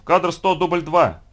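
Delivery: high, loud clapper call; loud, ringing slate call; shouted announcement. A narrator's reading. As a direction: shout out the take. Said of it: "крикнув".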